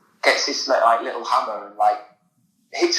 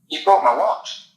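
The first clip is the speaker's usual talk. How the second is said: higher-pitched, aggrieved retelling; imitated protest, pitched up; mock accusation; 7 semitones higher than usual. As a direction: lightly act out a wronged man's complaint. Acts upset watch owner accusing magician.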